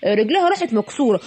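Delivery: ordinary talk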